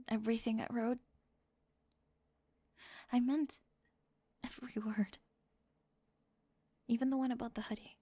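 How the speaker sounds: hushed, intimate cheerleader confessing to a classmate; soft roleplay confession. Acted. Soft-spoken roleplay confession scene.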